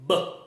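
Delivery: voiced B buzzing from the throat; sound B. Shown as a demonstration. Teacher showing the voiced B to the class. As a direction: say B with the vocal cords on. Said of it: "and then pronounce the letter".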